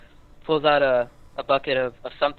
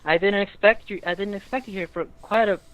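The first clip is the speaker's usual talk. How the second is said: put-on girl's voice; 6 semitones higher than usual. GM voices the girl.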